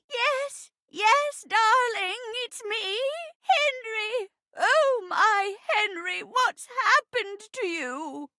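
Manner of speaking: high, theatrical tender fussing; overjoyed reunion. Acted. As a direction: gush over a long-lost husband. Acted reunion with amnesiac husband.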